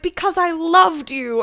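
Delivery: high, loud, drawn-out retold confession; mock love confession. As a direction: act out blurting a love confession, slowly and loudly. Confession climax of retold quarrel.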